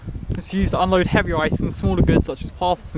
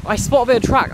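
excited; 5 semitones higher, faster than usual. Real, not acted. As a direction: call it out with delight. Excited repeat on spotting track.

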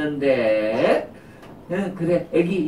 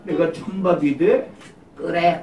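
usual speaking voice

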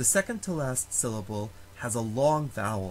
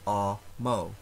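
"a-mo" split in two, weight on the A; stress on first syllable; 3 semitones lower than usual. Shown as a demonstration. Latin teacher showing how amo is pronounced, first-syllable stress. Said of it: "always the first syllable that is stressed".

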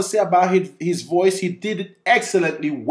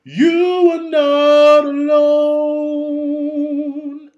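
deep, darkened singing tone; low larynx; 11 semitones higher, 7 dB louder, much slower than usual. Shown as a demonstration. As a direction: push the larynx down and sing. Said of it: "produce a really deep sound".

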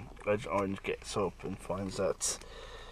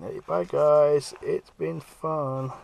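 slow, higher and louder mock farewell; drawn-out cheerful bye through the door; cheeky goodbye; 5 semitones higher, 6 dB louder, much slower than usual. Ice Warrior behind the wedged door to the guards, slow cheeky goodbye.

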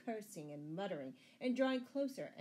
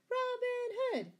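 high, loud, drawn-out cry; crowd's shout; 7 dB louder, slower than usual. A narrator's reading. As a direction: shout the name with full voice. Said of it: "shouted the people".

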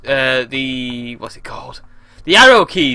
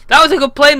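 high, loud protest; loud lament; 10 semitones higher, 7 dB louder, faster than usual. Real, not acted. Gamer mourning a crashed plane, loud protest. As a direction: cry out over the loss.

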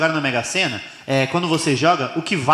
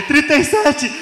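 very high-pitched number calling; frantic excitement; 11 semitones higher, slower than usual. Acted. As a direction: squeal the winning numbers in disbelief. Ricardo reading the last winning numbers.

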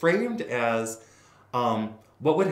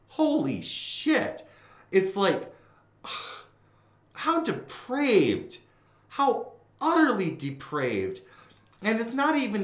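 high, slow, drawn-out disgust; scandalised; 10 semitones higher, 4 dB louder, slower than usual. Real, not acted. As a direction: savour the outrage slowly. Scandalised exclamation.

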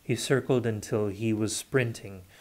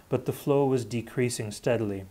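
usual speaking voice

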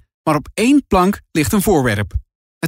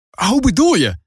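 higher, puzzled question; surprised; 8 semitones higher than usual. A narrator's reading. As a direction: ask it taken aback. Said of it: "vraagt Tess verbaasd". (Tess verbaasd tegen Lara)

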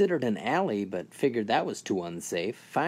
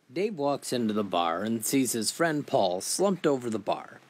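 ordinary talk, nothing marked

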